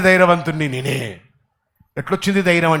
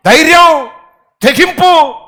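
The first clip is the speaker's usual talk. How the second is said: loud, high proclamation; shouted key words; 9 semitones higher, 8 dB louder, slower than usual. Real, not acted. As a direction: bark out each word. (గట్టిగా అరుస్తూ)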